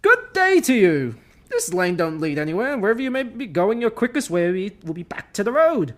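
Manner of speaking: a farmer shooing hobbits off his lane; blunt farmer voice; Farmer Maggot. Acted. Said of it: "read, uh, Farmer Maggot's lines".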